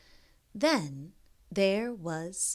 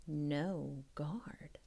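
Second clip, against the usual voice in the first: secretive storyteller whisper; suspense whisper; 5 semitones lower, 10 dB quieter than usual. A narrator's reading. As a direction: whisper No guard, much softer.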